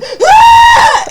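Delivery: very high, loud mock scream; retold scream. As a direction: let out a sudden shriek. Retelling her scream while crying.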